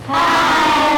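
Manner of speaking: put-on high squeaky puppet voice greeting kids; dummy voice. Ventriloquist dummy greets the boys and girls, high puppet voice. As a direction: chirp it like a little puppet.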